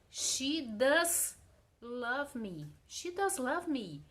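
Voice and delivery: "does" punched out as insistence; stress on DOES. Shown as a demonstration. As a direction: lean hard on "does". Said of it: "Tu enfatiza aquela situação".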